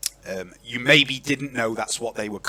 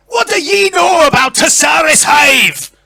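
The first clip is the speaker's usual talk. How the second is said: high, roaring Scottish challenge; broad Scottish accent; 12 dB louder than usual. Acted. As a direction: shout the question in thick Scots. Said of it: "I apologise in advance for this accent".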